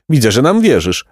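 restrained pelican squawk; shrieking pelican. A narrator's reading. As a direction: exclaim it with puffed-up satisfaction. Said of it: "wrzasnął Pelikan".

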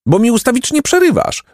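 very high-pitched exasperation; protest, fed up with being interrupted; impatient. A narrator's reading. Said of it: "rzekł zniecierpliwiony Jacek".

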